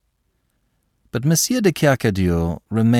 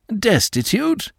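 incredulous; 14 semitones higher, slower than usual. Acted. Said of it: "the seigneur interrupted".

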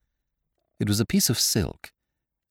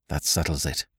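soft, low murmur of realisation; breathed; 7 semitones lower, 7 dB quieter than usual. A narrator's reading. Hastings to his companion on finding the theft, breathed quietly. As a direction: say it softly, low in the voice. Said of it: "I breathed".

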